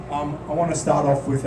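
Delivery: ordinary talk, nothing marked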